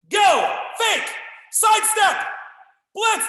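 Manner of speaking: high, loud, punchy drill calls; shouted commands. Real, not acted. Karate coach calling moves to online class, loud shouted calls. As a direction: bark each move out to the class.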